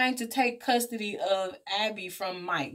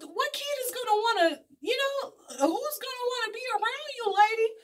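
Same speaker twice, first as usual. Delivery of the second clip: incredulous; 13 semitones higher than usual. Real, not acted. Ranting at the aunt on screen.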